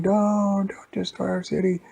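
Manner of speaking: high, loud mock cry of panic; acted screaming and yelling. Townspeople pleading as a robot attacks, high mock yell. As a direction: yell a plea like a panicked crowd. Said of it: "people were screaming and yelling".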